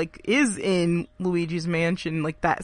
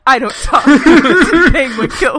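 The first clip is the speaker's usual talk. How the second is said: louder, cracking up mid-line; laughing through a quote; 5 semitones higher, 12 dB louder than usual. Retold or imitated. Friend retelling a grumpy penguin, laughing and loud. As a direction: giggle through the grumpy shopkeeper's words. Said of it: "and then he goes".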